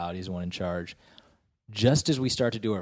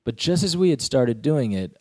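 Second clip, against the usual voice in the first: ordinary talk, nothing marked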